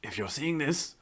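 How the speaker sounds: soft, put-on movie-hologram voice; imitating the film's line. Retelling the old founder's hologram message, solemn put-on voice. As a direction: quietly intone it like a recorded farewell. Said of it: "he gets to say the cool line of".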